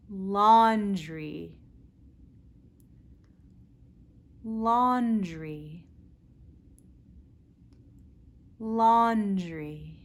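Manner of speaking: laundry, with a clear L; teacher repeating laundry; modelled L word. Shown as a demonstration. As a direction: say laundry slowly. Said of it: "Practice with me".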